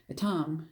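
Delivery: "Etam" as "uh-TAHM", open AH; tentative "Etam", said uh-TAHM; trying a pronunciation. Shown as a demonstration. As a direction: sound out "Etam" ending like Tom. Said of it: "I don't know how you pronounce it".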